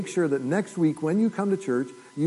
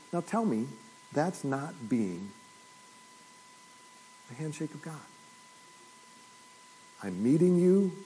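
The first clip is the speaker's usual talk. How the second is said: soft altar appeal; 2 semitones lower, 4 dB quieter than usual. Acted. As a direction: draw the congregation in softly. Pastor's soft, slow appeal.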